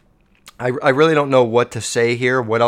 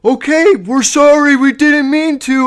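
hammed-up apology, much higher and louder; mock meek burglar; 12 semitones higher, 7 dB louder than usual. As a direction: play a sheepish burglar caving in. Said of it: "But I definitely wouldn't, like".

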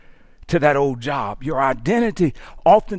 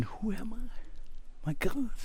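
hushed, lost question; 2 semitones higher, 14 dB quieter, slower than usual. Pretend. Lost person asking who am I.

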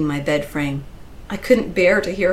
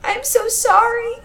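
put-on desperate little kid apologizing; pleading child; 14 semitones higher than usual. A narrator's reading. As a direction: beg forgiveness like a small girl. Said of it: "child pleading for her life".

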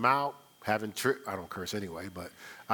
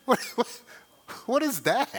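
mock disbelief; 7 semitones higher than usual. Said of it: "you know how we do".

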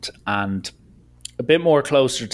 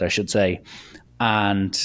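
ordinary talk, nothing marked

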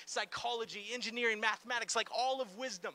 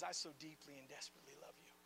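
tender God; 8 semitones lower, 14 dB quieter, faster than usual. Retold or imitated. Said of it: "and says".

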